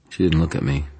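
plain reading voice

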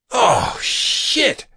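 high, startled curse; alarmed blurt. A narrator's reading. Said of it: "Harris blurted and spat out a mouthful".

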